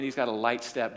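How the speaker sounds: normal talking voice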